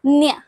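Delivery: अन्य said as "anya", n and y blended; ñ sound demo. Shown as a demonstration. Teacher shows the Spanish ñ to students, blending n and y. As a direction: glide न्य as one nasal "nya". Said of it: "बस ऐसे repeat करें मेरे पास".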